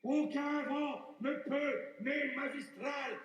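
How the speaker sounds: slow, drawn-out grandeur; emphatic. Acted. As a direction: stretch each phrase out grandly.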